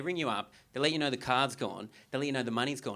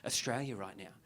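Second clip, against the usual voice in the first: a lightly voiced pompous voter, quieter; earnest self-important voter; 7 dB quieter than usual. An earnest voter lecturing about the world stage, lightly pompous. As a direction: preach it like it matters to the world. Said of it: "Or people are like".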